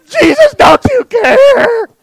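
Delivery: high, loud wail with a stretched CARE; hammed-up crying shout to heaven; mock desperate prayer. Churchgoers praying those storm verses at Jesus, mock desperate wail.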